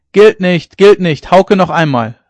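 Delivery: loud, high protesting shout. A narrator's reading. Hauke's teammates protest his short throw with a loud shout. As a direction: yell that the throw doesn't count. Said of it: "riefen seine Partner".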